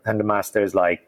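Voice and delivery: normal talking voice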